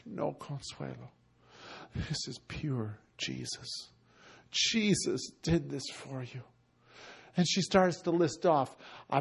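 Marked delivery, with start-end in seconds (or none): 0.1-6.4 much lower and much softer, slow; tender reply. Retold or imitated. Preacher telling Consuelo it was Jesus, softly. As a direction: say it gently, almost under your breath.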